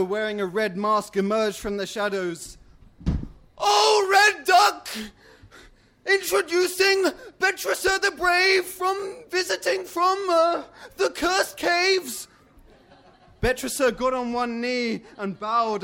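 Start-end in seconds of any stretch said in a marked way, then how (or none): 3.6-12.3 herald announcing. Acted. Masked creature presenting a visitor to the Red Duck, heraldic. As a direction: cry it out like a court crier.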